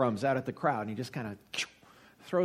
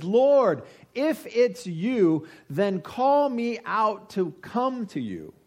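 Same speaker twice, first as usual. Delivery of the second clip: calling out; 7 semitones higher, 4 dB louder, much slower than usual. Acted. Peter yells out to Jesus.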